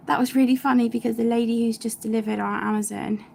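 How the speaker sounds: breathless after running downstairs; out of breath. Real, not acted. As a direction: talk while catching your breath. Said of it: "God, I'm so out of breath now".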